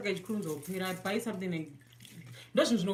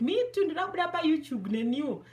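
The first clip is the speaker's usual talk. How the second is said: voicing ancestors; 9 semitones higher, 4 dB louder, faster than usual. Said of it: "The ancestors will be like".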